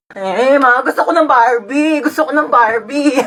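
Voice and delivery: tearful, drawn-out tantrum; whining child. Acted. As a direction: whine until mama gives in. Child throws tantrum for Barbie. (batang nagmamaktol)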